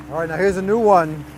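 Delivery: ordinary talk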